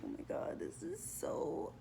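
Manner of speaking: hushed, exasperated near-whisper; slow, whispered annoyance trailing into a sigh; annoyed mutter. Retold or imitated. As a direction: retell it under your breath, fed up. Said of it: "I was so annoyed with him".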